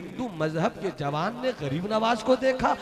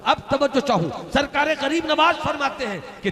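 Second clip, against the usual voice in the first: raised preaching call; 10 semitones higher, 6 dB louder than usual. Acted. Preacher calls for attention.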